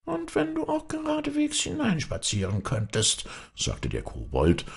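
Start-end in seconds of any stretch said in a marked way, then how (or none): 0.1-3.3 high, squeaky goblin. A narrator's reading. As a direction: pitch up into a tricky little kobold. Kobold voice in fairy tale.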